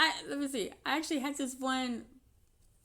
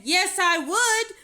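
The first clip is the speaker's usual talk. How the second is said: laughing retort; 10 semitones higher, 9 dB louder, slower than usual. Retold or imitated. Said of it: "I said".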